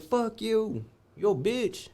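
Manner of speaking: high defiant repeat of the taunt; retold insult repeated. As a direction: throw it back at him, same pitch.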